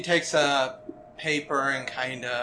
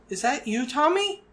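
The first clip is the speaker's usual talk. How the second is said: put-on high, wondering girl's voice; a sleepy kid recognizing her brother; young girl; 9 semitones higher than usual.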